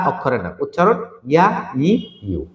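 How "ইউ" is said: normal talking voice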